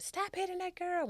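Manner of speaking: high mock yell, pitch jumps way up; retold scream. Mom yells at son to stop, high-pitched. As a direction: mom bursting in to stop a beating, yelling high. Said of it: "and screaming at him".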